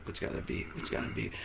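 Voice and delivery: soft whisper; whispered thought. Retold or imitated. Thinking which beat to play, whispered. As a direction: whisper it to yourself. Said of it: "I'm just thinking".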